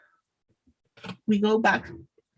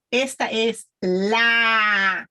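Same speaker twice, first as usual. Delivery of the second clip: "Esta es la" with "la" held very long and louder; emphatic article; 3 dB louder, much slower than usual. Shown as a demonstration. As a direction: stretch out "la" as the key word. Teacher stresses the missing article.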